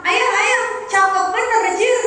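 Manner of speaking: a child parroting his father, mildly voiced; imitating a small child. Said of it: "kata anaknya". (menirukan anak kecil dengan suara tinggi)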